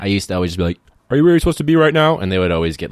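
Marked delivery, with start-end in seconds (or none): 1.1-2.2 a loud, high, fast hall-monitor challenge; teacher voice. Retold or imitated. High schooler faking a teacher to scare students in the hall, loud and fast. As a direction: scare a kid into thinking you are staff. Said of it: "like, the teacher voice".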